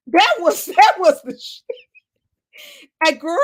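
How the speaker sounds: laughing. Real, not acted. Still laughing at the comment.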